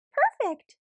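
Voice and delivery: overly cheerful helper; chirpy eager reply. A narrator's reading. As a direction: squeak it out eagerly to please. Bunny oddly helpful answering Mama.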